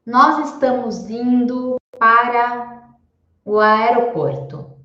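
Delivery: deliberate teacher demo; slow model sentence. Shown as a demonstration. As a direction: read it slowly for the class. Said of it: "se eu falar".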